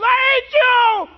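loud, high, drawn-out shout; acted rage at God. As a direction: yell it slowly, as if shaking a fist at heaven. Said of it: "shakes their hand at God and says".

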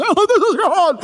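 high, loud, wavering parody voice; TV-show God. Pretend. Preacher spoofing how TV shows portray God, hammed-up. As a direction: ham it up as a television God. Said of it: "those T V shows make us".

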